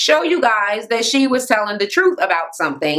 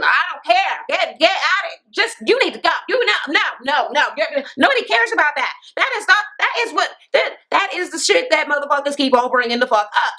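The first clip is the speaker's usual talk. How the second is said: high-pitched mock rant, words tumbling out fast in places; retold angry rant; 6 semitones higher, faster than usual. Tanya telling Plastic to get out, high mock rant. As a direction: play Tanya snapping that nobody cares, voice pitched way up.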